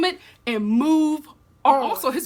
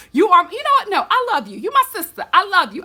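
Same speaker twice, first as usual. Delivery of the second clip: higher, louder heartfelt appeal; impassioned plea; 7 semitones higher, 5 dB louder, faster than usual. Real, not acted. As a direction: plead with her like a sister. Pleading to Rachel on screen.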